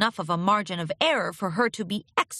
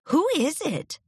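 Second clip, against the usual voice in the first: bright mare voice; 8 semitones higher than usual. Acted. Lyra voiced calling out.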